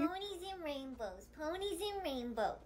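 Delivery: soft, low murmur; sleepy self-soothing. Acted. Girl trying to fall asleep happily, murmuring softly.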